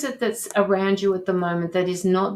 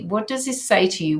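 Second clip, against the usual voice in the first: ordinary delivery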